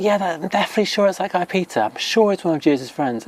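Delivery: high, fast and certain, lightly voiced gossip; a touch of a bystander pointing the finger; sure bystander. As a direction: insist like a nosy onlooker. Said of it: "they were like".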